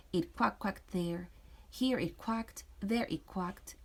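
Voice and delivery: ordinary delivery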